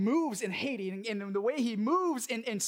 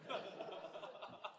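breathy laugh; 2 semitones lower, 17 dB quieter than usual. Real, not acted. Laughing at his lost money.

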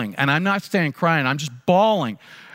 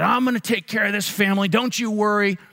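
angry vow; 9 semitones higher than usual. Retold or imitated. Dad jumps up, angry vow.

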